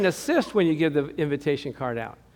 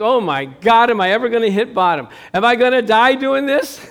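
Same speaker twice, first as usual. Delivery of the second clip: loud, raised mock panic; panicky kid; 5 semitones higher, 7 dB louder than usual. Kid on the high dive fears dying, mock panic. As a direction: exaggerate a child's dread at full voice. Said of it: "I felt like that kid".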